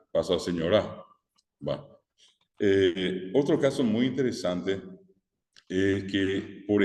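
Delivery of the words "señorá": "señora" said señorÁ, rising onto the final A; Guarani final stress. Shown as a demonstration. Teacher shows the word turned Guarani, "señora" stressed on the end. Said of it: "Al guaranizarse".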